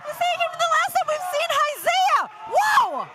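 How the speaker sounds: very high, loud shout; lucha libre announcer. Real, not acted. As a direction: shriek with excitement. Surprise return in the arena.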